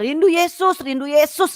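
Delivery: high, repeated cry at normal volume; retold high wail of longing; high-pitched outcry.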